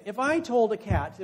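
usual speaking voice

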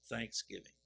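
usual speaking voice

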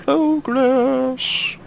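very high, playful echo; silly high voice. Pretend. Player echoing their own declaration of progress, silly high voice.

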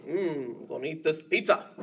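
low, fast dragon voice about pizza; a hint of a hungry dragon; lightly voiced greedy dragon. Dragon voice, dancing away.

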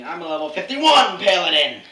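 loud offended correction; indignant shout. Acted. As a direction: shout it, deeply insulted. Insulted gamer corrects friends.

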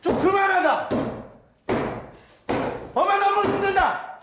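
shout. Shown as a demonstration. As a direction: yell it out. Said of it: "크게 얘기해서".

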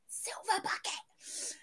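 breathy whisper, slowed; whispered silver bucket. Pretend. Streamer whispering an emoji's name to chat. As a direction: hush it like a secret.

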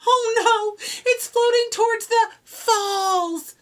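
worried high cry; alarmed as the flute nears the falls; cried. A narrator's reading. Fifi crying out as the flute drifts toward the falls. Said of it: "Fifi cried".

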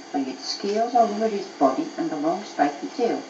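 ordinary delivery

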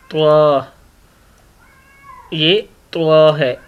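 त्वा drawn out slowly and heavy; slow the word त्वा right down; heavy letter demo. Shown as a demonstration. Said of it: "पढ़ के देख लें".

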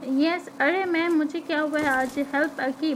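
ordinary delivery

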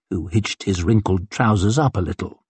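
ordinary delivery

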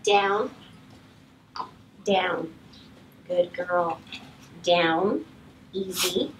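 low, soft, settling commands; calming low command. Shown as a demonstration. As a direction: bring the energy back down. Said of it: "shut her energy back down".

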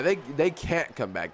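usual speaking voice

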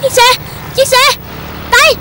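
sudden alarm, pitch rising. Acted. Boy crying out at the missing bike, alarmed.

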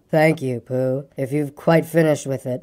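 low, gloomy donkey voice; gloomy, long-suffering and low; a low, woeful grumble; melancholy grumbler. A narrator's reading. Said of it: "in his melancholy way".

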